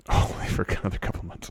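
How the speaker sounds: whispered mocking echo. Teasing cohost by echoing his words in a whisper.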